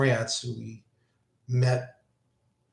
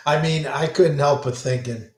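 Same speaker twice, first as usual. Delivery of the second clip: ordinary talk, nothing marked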